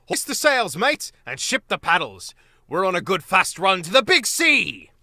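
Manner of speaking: a jolly river captain calling his crew; hearty captain's shout. Acted. Otter captain shouting orders.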